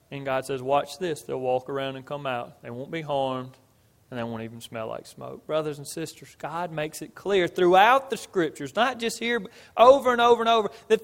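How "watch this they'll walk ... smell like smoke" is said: low, soft, understated answer; calm assurance. Retold or imitated. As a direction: answer the boast quietly and surely, trailing softer. God's calm answer to king's boast.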